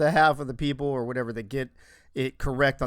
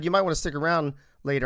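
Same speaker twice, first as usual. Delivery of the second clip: ordinary talk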